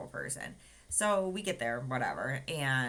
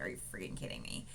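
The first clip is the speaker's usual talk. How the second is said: fast whisper under the breath; whispered disbelief; 11 semitones lower, 7 dB quieter, faster than usual. Retold or imitated. Tearful patient on the table, a fast whispered disbelief. Crying in surgery, muttering.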